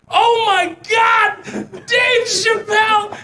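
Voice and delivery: high, slow starstruck squeal; starstruck freak-out. Retold or imitated. Fan spotting Dave Chappelle in Minneapolis, high starstruck squeal. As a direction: lose it at seeing a celebrity.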